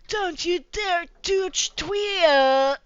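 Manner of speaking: a lightly voiced sneering Ed; mock scornful snort. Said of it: "snorted Ed".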